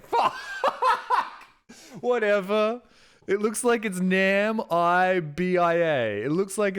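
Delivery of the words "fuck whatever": high laughing curse; embarrassed laugh. Real, not acted. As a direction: laugh it off, caught out. Caught mispronouncing, laughing it off.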